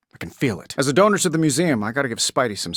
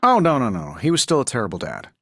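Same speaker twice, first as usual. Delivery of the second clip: ordinary talk, nothing marked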